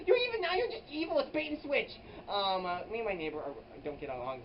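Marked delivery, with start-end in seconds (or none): 0.1-1.9 indignant squeal. Retold or imitated. Quoting own retort.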